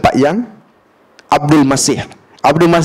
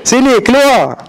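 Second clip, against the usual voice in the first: mock shout; 7 semitones higher, slower than usual. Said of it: "Bila dia jerit-jerit macam tu".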